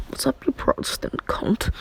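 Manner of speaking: voiceless whisper in a put-on voice; mock sinister whisper; whispered taunt. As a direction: sneak the insult out in a whisper. Reincarnated character greets rudely.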